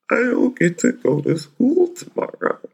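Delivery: put-on high, whimpering little-boy voice; imitating a whimper. Dad imitates son whimpering in the car, high and whiny. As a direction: whine it like an injured puppy. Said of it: "sort of whimpering like a".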